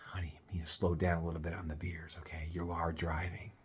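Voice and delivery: hushed whispered warning from his date. Retold or imitated. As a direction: lean in and whisper it. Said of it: "my date leans over and she goes".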